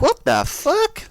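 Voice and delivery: mock disbelief. Aris baffled by the attack, slow high disbelief. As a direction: stretch it out, utterly baffled. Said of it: "He's like".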